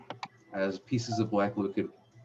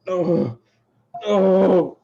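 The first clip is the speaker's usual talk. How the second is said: high, loud cry of "oh"; two loud, high-pitched agonized ohs; acted struggling cry; 10 semitones higher, 12 dB louder than usual. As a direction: cry out as the head is seized. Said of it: "you see Ravish's head go".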